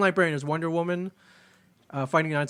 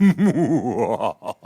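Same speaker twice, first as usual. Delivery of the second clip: sinister villain laugh; evil chuckle; 5 semitones lower, 6 dB louder than usual. Pretend. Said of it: "wants me to give my most sinister laugh".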